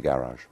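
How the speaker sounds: garage said "GARR-ahzh", low; southern pronunciation. Shown as a demonstration. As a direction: say garage with a soft "zh" ending. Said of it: "I'm a soft southerner".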